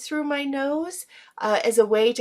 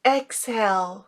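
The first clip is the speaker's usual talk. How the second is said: calm exhale cue. Shown as a demonstration. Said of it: "let's practice it one more time".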